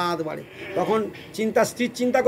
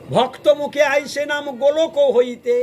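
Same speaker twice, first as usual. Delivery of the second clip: slow, raised recitation of scripture; recited verse; 4 semitones higher, 4 dB louder, slower than usual. Shown as a demonstration. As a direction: intone the verse slowly. (ধীরে সুর করে শ্লোক আবৃত্তি)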